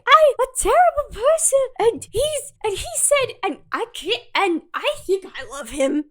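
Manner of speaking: very high, hammed-up weepy wailing; mock crying. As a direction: blubber a confession in fake hysterics. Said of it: "still crying and upset".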